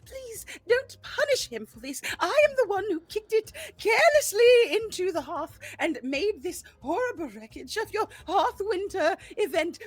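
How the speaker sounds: high, earnest plea to spare the brother; pleading charm. Acted. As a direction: plead like big Puss in Boots eyes. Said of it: "charm-filled face they can possibly muster".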